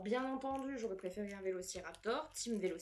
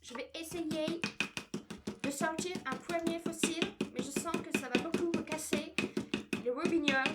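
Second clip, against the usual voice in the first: French said in a hammed-up English accent, higher and louder; mock English accent; 6 semitones higher, 8 dB louder than usual. Telling her viewers she's now speaking English, a hammed-up English accent. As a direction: play a Brit mangling French. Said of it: "je parle plutôt juste avec l'accent anglais".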